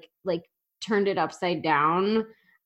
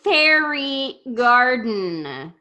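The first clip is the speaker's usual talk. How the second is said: very slow, drawn-out "Fairy garden"; helpful clarification; 3 semitones higher, 3 dB louder, much slower than usual. Retold or imitated. Host echoing the judge who translated for Paul, "Fairy garden" drawn out slowly. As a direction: stretch each word as if translating. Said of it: "Sandy stepped in or Prue and was like".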